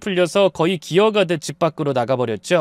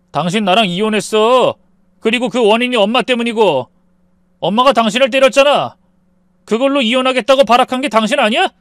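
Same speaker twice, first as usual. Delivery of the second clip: higher, louder accusing voice than narration; indignant wife; 5 semitones higher, 6 dB louder than usual. A narrator's reading. Wife confronting ex-husband over their divorce, indignant. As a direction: throw the blame back at him.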